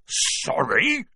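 barked. A narrator's reading. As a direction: throw the word back as an indignant bark. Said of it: "barked Hagrid".

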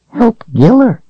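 very high, tiny doll voice; sweet little toy giving advice. Acted. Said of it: "the doll said".

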